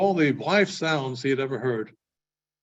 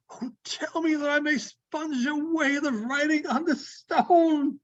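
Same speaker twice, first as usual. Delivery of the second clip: high, pleading vow, slowing at the end; fervent Scrooge vow; 9 semitones higher than usual. Acted. Scrooge vowing to the spirit, high and fervent. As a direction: swear it with your whole heart.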